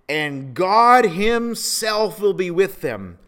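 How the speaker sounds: "himself" drawn out high and loud; emphasis on himself. Acted. Preacher reads God's promise to the church, "himself" heavily emphatic. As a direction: lean hard and slowly on "himself". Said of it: "Do you notice that emphasis?".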